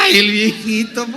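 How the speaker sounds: high, quick mocking disbelief; incredulous Sara. Sara eyes her old husband, amused incredulity. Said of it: "Y miraba al viejito y decía".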